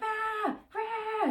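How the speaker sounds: high, frantic yelling burst; a hammed-up shriek of panic; mock panicked yell. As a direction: yell like someone on fire, half-joking. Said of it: "running around being like".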